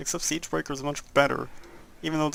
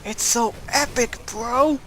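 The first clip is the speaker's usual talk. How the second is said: slow stretched hype; mock hype; 6 semitones higher, slower than usual. Real, not acted. Gamer hyping loot, slow stretched hype. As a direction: drag out the hype.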